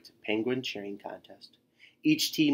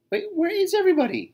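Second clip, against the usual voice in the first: high, louder, fast puzzled voice; puzzled wondering; 6 dB louder, faster than usual. A narrator's reading. Tacky lost from the team, puzzled, high and fast. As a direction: sound suddenly lost. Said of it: "he wondered".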